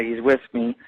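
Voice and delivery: ordinary delivery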